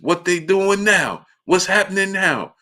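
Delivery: mock confused old man asking what's happening; imitating blind great-uncle. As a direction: play the old uncle pestering for updates. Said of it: "the whole time it's just".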